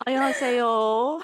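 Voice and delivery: slow, loud drawled Southern Korean greeting; Southern-accented Korean. Acted. Host teases co-host with a Korean greeting, mock Southern accent. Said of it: "do that in a Southern accent".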